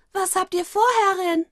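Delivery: put-on very high, childlike voice; high childish servant voice; curious little girl. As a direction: ask the mistress innocently. Maru child spirit voice.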